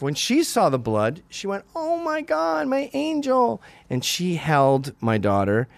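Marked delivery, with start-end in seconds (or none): 1.7-3.6 very high, cooing wife voice; doting mother impression. Wife to her bleeding daughter, high doting concern. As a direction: imitate a mother fussing over her child.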